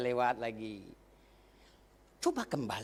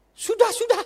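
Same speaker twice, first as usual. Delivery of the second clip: choking up; 16 dB louder than usual. Real, not acted. Pastor recalls being brushed off.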